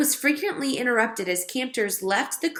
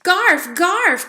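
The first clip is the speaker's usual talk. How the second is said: high, loud call across the room; 10 semitones higher, 8 dB louder than usual. A narrator's reading. Girl calling to Garth across the shop. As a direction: call his name to get his attention. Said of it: "called Lana".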